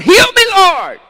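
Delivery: high, loud desperate cry to God. Retold or imitated. Preacher voicing a believer crying to the Lord, desperate shout. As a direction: shout it up like a plea to heaven.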